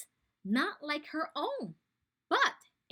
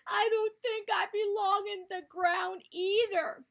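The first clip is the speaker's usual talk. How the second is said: high, louder sad little creature; crying platypus; 6 semitones higher, 5 dB louder than usual. Acted. Sad platypus tells the animals, tearfully high. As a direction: whimper it like a sad baby animal. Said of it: "began to cry".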